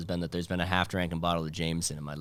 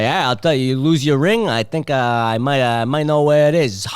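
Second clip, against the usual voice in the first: louder, higher bro voice; loud mock Boston bro; hammed-up Boston bro; 6 semitones higher, 11 dB louder than usual. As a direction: sell it like a sleazy fan. Comedian's stage persona.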